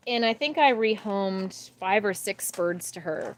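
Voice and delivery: usual speaking voice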